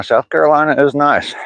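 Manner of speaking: South Carolina said high and sing-song Southern. Shown as a demonstration. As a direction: lean into a Southern twang on Carolina. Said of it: "reads comment then repeats".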